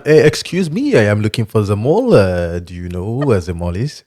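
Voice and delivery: lightly put-on French tourist; French accent. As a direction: fake French accent, slow. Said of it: "t'aimes prétendre que tu parles le français".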